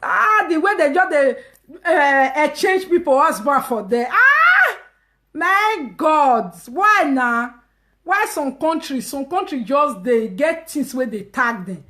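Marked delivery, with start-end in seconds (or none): 4.1-7.5 a high, loud gasp of disbelief; shocked exclamation. Real, not acted. Commenting on wives trading husbands, shocked high cry.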